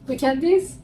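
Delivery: ordinary talk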